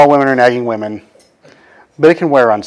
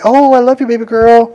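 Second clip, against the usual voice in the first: high, sweet mock toddler voice; cuddly toddler; 9 semitones higher than usual. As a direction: coo it like a clingy little girl. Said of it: "and be like".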